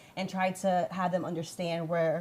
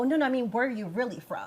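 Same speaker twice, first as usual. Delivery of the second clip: imitating pushy questioner; 6 semitones higher, 5 dB louder than usual. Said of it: "then they go".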